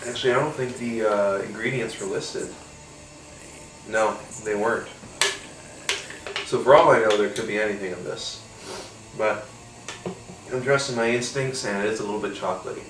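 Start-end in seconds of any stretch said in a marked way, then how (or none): none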